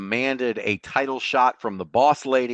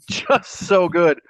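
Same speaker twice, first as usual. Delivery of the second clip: laughing; 7 semitones higher, 5 dB louder, much slower than usual. Real, not acted. Laughing at the hillbilly story.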